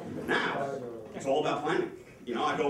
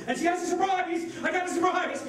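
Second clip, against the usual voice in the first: imitating an excited girlfriend, pitched high; high, hammed-up excitement; mock excited; 9 semitones higher than usual. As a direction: put on a girlfriend's high, giddy announcement. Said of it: "she's all fucking excited".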